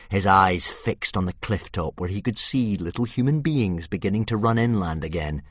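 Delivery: usual speaking voice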